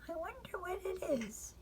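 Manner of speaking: high, hushed, fearful; a child sure the monster is coming; scared little girl. Acted. Frances alone in bed, frightened of the noise.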